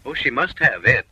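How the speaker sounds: ordinary talk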